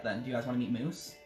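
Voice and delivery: usual speaking voice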